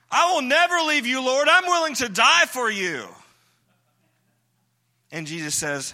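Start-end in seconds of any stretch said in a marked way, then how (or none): 0.1-3.1 loud, high, cocky vow; boastful Peter. Acted. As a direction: brag that you'd never leave him. Peter boasting loyalty to Jesus.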